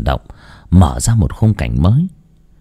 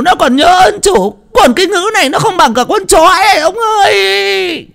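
high shrill wailing, louder, crying out to her husband; wailing tantrum; 4 dB louder than usual. A narrator's reading. Old mistress throwing a fit, wailing to her husband. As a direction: throw a screaming fit, sobbing and howling. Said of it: "miệng bắt đầu gào cả lên".

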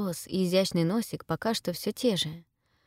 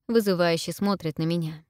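usual speaking voice

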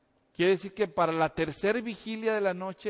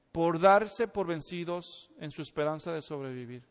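ordinary talk, nothing marked

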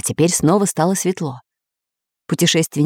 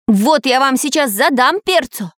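high-pitched threatening call; Самоделкин, fearsome cry; 7 semitones higher than usual. A narrator's reading. Little iron man threatening skeletons, mildly fearsome cry. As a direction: threaten them in a raised, scary voice. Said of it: "страшным голосом крикнул Самоделкин".

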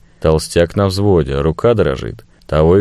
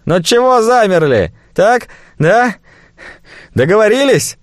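ominous drawl; 14 semitones higher than usual. Acted. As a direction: stretch it out with menace. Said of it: "зловеще протянул".

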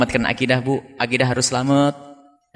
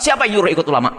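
higher-pitched retold angry demand; mock angry; 6 semitones higher, faster than usual. Professor losing his temper in a debate, mock angry. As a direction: imitate an opponent's irritated challenge, pitch raised. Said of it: "dia marah, emosi sampai mengatakan".

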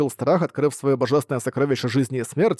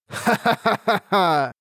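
slow, high evil cackle; sinister laugh; 6 semitones higher, much slower than usual. Acted. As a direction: cackle ominously from within. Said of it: "раздался зловещий голос".

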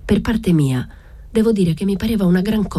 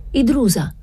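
calling out; 10 semitones higher than usual. A narrator's reading. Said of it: "mi gridò".

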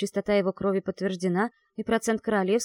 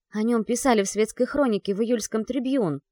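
ordinary delivery, nothing marked

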